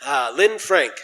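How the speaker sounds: normal talking voice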